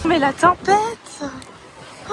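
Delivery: slow, high, loud cry; excited exclamation. Real, not acted. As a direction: shout it out over the wind. Caught in a sandstorm.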